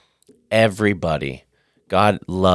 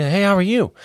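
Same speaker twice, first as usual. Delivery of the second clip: cheery hello; 12 semitones higher than usual. Retold or imitated. Greeting a sad stranger.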